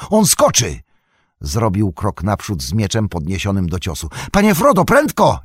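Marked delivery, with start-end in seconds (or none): 0.1-0.8 loud, high warning; alarmed shout. A narrator's reading. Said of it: "krzyknął Sam". 4.3-5.4 high, loud, pleading call; urgent cry. A narrator's reading. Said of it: "jęknął".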